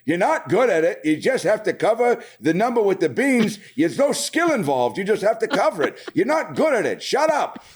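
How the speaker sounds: mock a grumpy husband nagging, pitch up; bickering husband. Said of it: "the husband's like".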